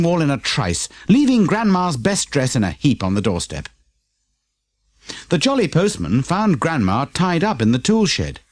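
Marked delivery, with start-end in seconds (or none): none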